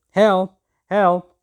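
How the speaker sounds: loud, high panicked cry of help. A narrator's reading. Bird caught by a man, crying loud for help. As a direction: yell for help as hands grab you.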